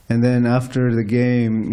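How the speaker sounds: ordinary delivery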